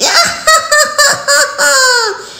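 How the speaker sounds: high evil cackle; witch cackle. A narrator's reading. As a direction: cackle like a hag. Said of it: "cackled the witch".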